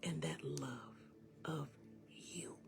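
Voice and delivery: very soft, breath-only whisper; hushed encouragement. Real, not acted. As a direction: whisper gently to comfort them, with long pauses. Drops into hushed, tender encouragement.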